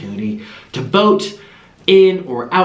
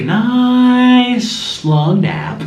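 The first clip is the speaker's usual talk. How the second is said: drowsy and slow; sleepy voice; 9 semitones higher, 4 dB louder, much slower than usual. Real, not acted. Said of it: "really want a nap".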